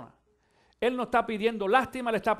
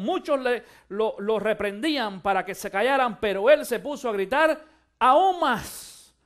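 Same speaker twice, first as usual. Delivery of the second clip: louder, booming sermon cadence; preaching at full force; 2 semitones higher, 6 dB louder than usual. Acted. Pastor to his congregation about crying out to God, full-force preaching. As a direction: rouse the church, driving each phrase home. Preaches the blind man's cry.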